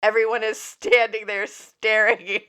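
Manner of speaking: ordinary delivery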